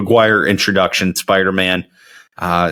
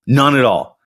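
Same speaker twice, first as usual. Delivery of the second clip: normal talking voice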